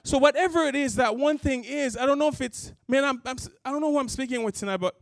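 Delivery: high, loud appeal to the crowd; impassioned preaching. Acted. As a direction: plead with the congregation, full force.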